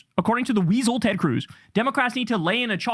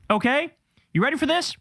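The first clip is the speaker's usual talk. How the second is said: normal talking voice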